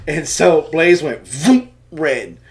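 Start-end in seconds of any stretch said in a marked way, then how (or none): 1.2-1.7 blushing sound effect. Retold or imitated. Son turns red.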